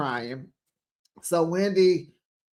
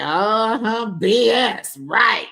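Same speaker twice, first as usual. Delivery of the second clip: sassy agreement; 5 semitones higher, 4 dB louder, slower than usual. Retold or imitated. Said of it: "And Giselle like".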